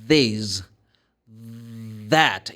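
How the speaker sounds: long buzzing th starting "This" and "That"; voiced TH. Shown as a demonstration. Teacher demonstrating vocal cord vibration, exaggerated voiced th in "This" and "That". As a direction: hold a voiced th before releasing "This", "That". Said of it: "You vibrate the vocal cords".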